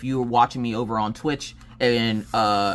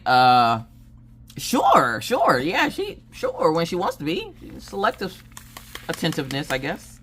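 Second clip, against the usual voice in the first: awkward hedging; 4 semitones higher than usual. Retold or imitated.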